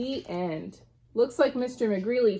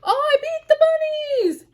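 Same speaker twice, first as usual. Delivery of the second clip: much higher, louder gleeful cry; whooping; 8 dB louder than usual. A narrator's reading. McGreely celebrating beating the bunnies, whooping with glee. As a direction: whoop it out in triumph. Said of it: "he whooped".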